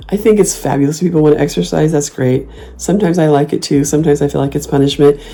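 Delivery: usual speaking voice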